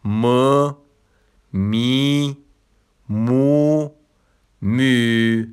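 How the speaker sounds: teacher repeating the four suffixes loud and clear; suffixes pronounced again. Shown as a demonstration. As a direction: model each suffix slowly and separately.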